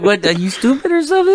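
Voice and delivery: high, fast, incredulous; exasperated. Retold or imitated. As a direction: scoff at her in disbelief. Exasperated at sister.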